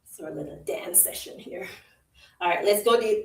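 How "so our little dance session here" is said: whispered, almost to herself; whispered aside. Real, not acted. Instructor whispering an aside mid-workout. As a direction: murmur it under your breath.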